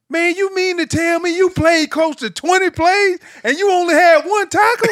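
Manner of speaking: high, loud Southern mockery in the film room; mock him in a high Southern twang. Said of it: "Renard had this Southern twang".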